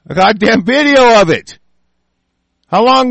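a raging outburst; angry shout. Real, not acted. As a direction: yell it, furious at the cover-up. Peak of an angry rant.

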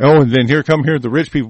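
normal talking voice

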